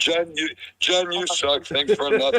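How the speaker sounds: high, hammed-up angry troll; shill voice. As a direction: play a whiny furious shill. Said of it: "I'm a shill".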